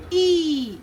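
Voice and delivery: long, emphatic ee on "E"; stressed long E. Shown as a demonstration. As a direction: lean on "E" and stretch it. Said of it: "You stress on the sound and say".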